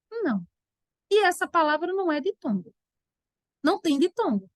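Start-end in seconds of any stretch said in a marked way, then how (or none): none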